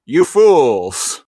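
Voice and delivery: loud bad villain impression; a cheesy sound-alike villain; roaring tyrant king. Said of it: "with a terrible sound-alike being like".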